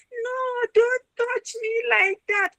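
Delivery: high, slow, playful refusal; half-hearted no. Shown as a demonstration. Girl playfully refusing a boy's touch, high and slow. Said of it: "not really saying it meaningly from your heart".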